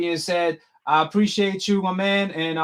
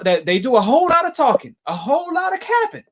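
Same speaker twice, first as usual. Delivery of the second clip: louder, rising to a high pitched yell on capping; ranting to the stream audience, high and loud; heated repeat; 8 semitones higher, 4 dB louder than usual. Real, not acted. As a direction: hammer it home the second time, getting loud.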